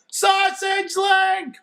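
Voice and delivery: very high, slow, drawn-out holler of "Sausage Link!"; boy hollering for the runaway puppy. A narrator's reading. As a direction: call "Sausage Link!" long and loud across the yards. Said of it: "I holler".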